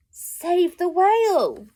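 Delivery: slow, loud high proclamation; dramatic reveal. A narrator's reading. Reader revealing the trail message, loud and slow. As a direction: announce the message grandly.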